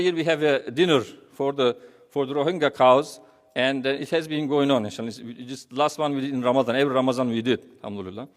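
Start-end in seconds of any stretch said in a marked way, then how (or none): none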